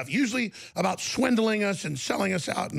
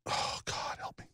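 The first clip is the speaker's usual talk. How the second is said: whispered plea; 11 dB quieter than usual. Real, not acted. Host to himself, whispered prayer of exasperation.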